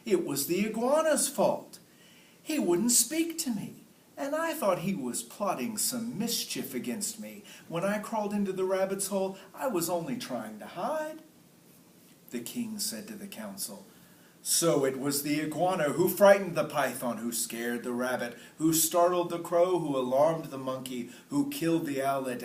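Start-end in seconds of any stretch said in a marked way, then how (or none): 0.0-11.2 python's voice. Acted. Python pleads its case. 14.4-22.4 king's voice. Acted. King sums up to council.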